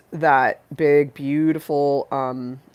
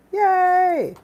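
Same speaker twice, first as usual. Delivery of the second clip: high squeaky yay; 6 dB louder than usual. Real, not acted.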